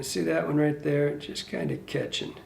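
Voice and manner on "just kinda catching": whispered. Real, not acted. Muttering while working.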